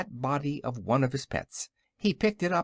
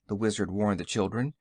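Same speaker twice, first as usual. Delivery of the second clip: usual speaking voice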